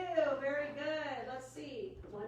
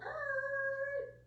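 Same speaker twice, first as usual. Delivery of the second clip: high screeching brake noise; skidding screech; 13 semitones higher than usual. A narrator's reading. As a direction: make the sound of hooves skidding. Horse skidding to a halt.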